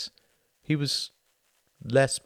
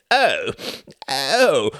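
faltering; 7 semitones higher, 7 dB louder than usual. A narrator's reading. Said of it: "faltered Reginald".

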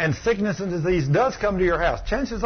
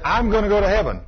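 higher and louder, sure of himself; defiant insistence; 6 semitones higher, 5 dB louder, faster than usual. Retold or imitated. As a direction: push back hard, certain of heaven. Man defiantly insists on salvation.

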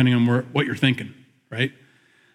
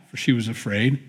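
normal talking voice

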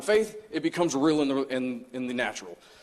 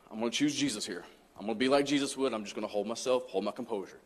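softer and lower, sinking into calm; fading inner resolve; 4 semitones lower, 6 dB quieter than usual. Retold or imitated. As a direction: talk yourself down quietly. Retold inner resolve to stay calm.

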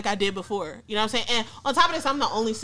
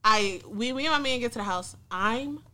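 ordinary delivery

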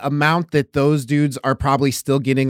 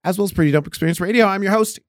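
radio host intro; 6 semitones higher than usual. Acted.

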